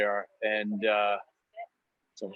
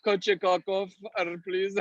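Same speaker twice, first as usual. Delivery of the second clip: higher-pitched mock Russian accent; 6 semitones higher than usual. Shown as a demonstration. Customer giving barista a prank name, Russian accent. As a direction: order politely in a Russian accent. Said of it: "do that with a Russian accent".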